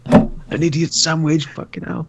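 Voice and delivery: slow, high put-on voice, ending in a breath; a parody of a celebrity chef's insult; mock chef line. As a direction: drawl it like a TV chef. Quoting the idiot sandwich meme.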